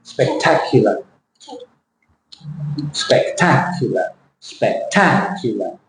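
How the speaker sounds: spectacular slowly, TAC held and -ular falling away; stress on TAC. Shown as a demonstration. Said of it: "Where is the stress?".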